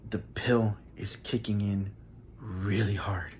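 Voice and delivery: low and soft, slowed, as if the pill is taking over; hushed drugged murmur. Retold or imitated. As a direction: murmur it to your wife so nobody hears. Drugged, telling wife in line.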